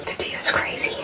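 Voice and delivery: barely audible awed whisper; whispered aside to a friend. Real, not acted. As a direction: breathe it out in a hush. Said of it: "Don't talk like that".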